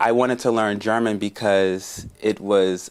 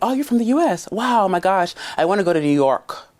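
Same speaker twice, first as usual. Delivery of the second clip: much higher, gushing French fan voice; excited French local; 10 semitones higher than usual. Retold or imitated. French local meeting an American, high and gushing. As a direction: gush about New York like an eager local. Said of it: "And I was like".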